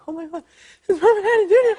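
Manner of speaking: high, laughing starstruck gasp; panicked starstruck. Retold or imitated. Young actor meeting his idol, starstruck panic. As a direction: squeal it like a panicking fan. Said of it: "I'm starting to panic".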